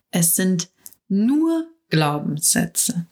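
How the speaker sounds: "nur" lifted and stretched, slow; slow emphasis landing on "nur"; stress on nur. Shown as a demonstration. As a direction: lean hard on "nur" and take your time. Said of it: "Mit der Betonung auf nur".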